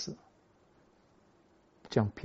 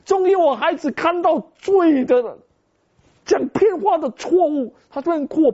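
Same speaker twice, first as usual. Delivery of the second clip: much higher and louder, near tears of joy; overjoyed preaching; 13 semitones higher, 5 dB louder than usual. Acted. Pastor reliving hugging his crying son, overjoyed. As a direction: cry out the joy and thanks to God. Joyful climax of testimony.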